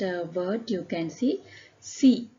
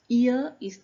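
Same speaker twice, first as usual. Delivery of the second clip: ordinary talk, nothing marked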